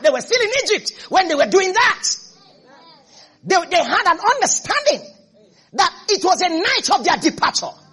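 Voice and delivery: high, loud, driving sermon voice; impassioned preaching. Acted. Preacher rousing the church about a harvest of miracles, impassioned. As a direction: build to a ringing proclamation.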